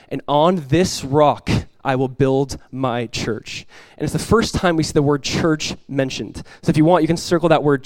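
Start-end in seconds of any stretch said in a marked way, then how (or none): 0.1-3.7 slow emphatic. Acted. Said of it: "slow emphatic quote of Jesus".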